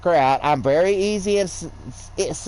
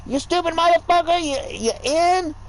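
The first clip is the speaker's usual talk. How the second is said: high-pitched mimicry of her trash talk; mocking her cursing; 11 semitones higher than usual. Imitating his girl cursing at Rocket League teammates. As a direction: sneer her insult back in a higher voice. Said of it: "she was in there fucking".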